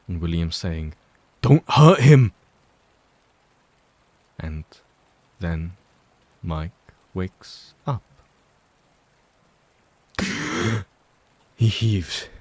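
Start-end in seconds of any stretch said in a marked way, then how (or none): 1.4-2.3 loud, high shout; protective shout. A narrator's reading. William shouts to stop Henry hurting Mike, loud and high. 10.2-10.8 sharp waking gasp. A narrator's reading. Waking with a start.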